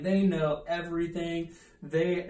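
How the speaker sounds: usual speaking voice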